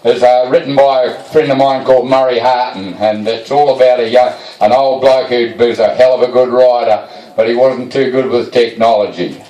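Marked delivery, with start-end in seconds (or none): none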